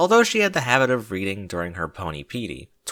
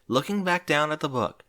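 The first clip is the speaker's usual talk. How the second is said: plain reading voice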